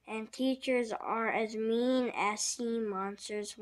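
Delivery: plain reading voice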